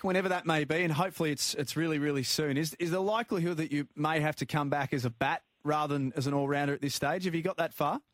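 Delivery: ordinary talk, nothing marked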